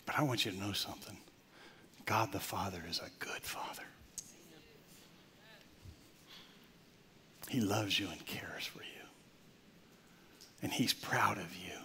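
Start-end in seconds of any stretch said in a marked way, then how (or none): 2.1-11.8 softer, whispered reassurance; tender whisper. Real, not acted. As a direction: hush it like a promise.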